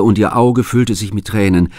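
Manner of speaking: ordinary delivery, nothing marked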